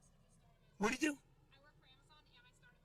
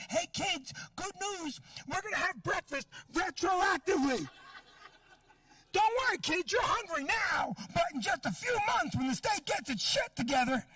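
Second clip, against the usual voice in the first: mock cheerful announcement; 7 semitones higher than usual. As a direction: sell the bad news with manic cheer. Mock announcement to hungry kids.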